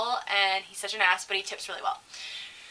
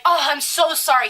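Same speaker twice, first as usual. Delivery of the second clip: mock-apologetic mom, higher and louder; 4 semitones higher, 6 dB louder than usual. Mom apologizing for her son, gushing and raised. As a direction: gush a mom's apology. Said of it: "And the mom's like".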